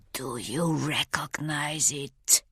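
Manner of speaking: slow, soft whisper; hushed, drawn-out threatening whisper; menacing whisper. A narrator's reading. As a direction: whisper it slowly, close into the ear. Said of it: "he whispers in my ear".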